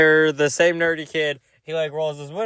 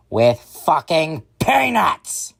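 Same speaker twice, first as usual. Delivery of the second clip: slow, high mock outrage; hammed-up drunken teacher complaint; indignant rant; 4 semitones higher, 3 dB louder, much slower than usual. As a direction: spit out each word slowly, outraged. Drunk teacher girlfriend's ranting complaint.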